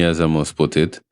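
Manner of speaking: usual speaking voice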